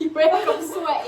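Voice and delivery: loud laughing tease. Real, not acted. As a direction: blurt it out while laughing. Teasing friend, bursts out laughing.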